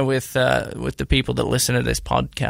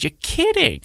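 surprised disbelief; 14 semitones higher than usual. Retold or imitated. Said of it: "I said".

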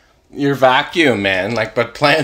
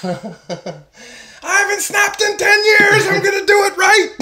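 snapping calm person. Pretend. Calm person finally losing it.

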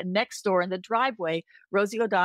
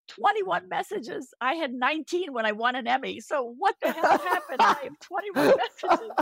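incredulous; 9 semitones higher than usual. Retold or imitated. Coming home to a full answering machine, high laughing disbelief. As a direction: stretch out "Twenty-one messages?" in amazed disbelief. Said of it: "And I'm like".

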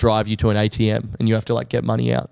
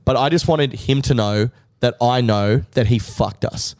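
ordinary talk

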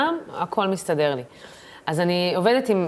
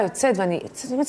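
usual speaking voice

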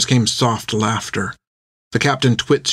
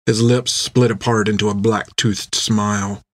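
ordinary delivery, nothing marked

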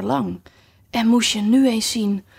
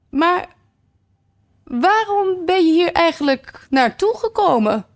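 friendlier tone; 9 semitones higher, 3 dB louder than usual. Acted. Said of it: "op een vriendelijker toon".

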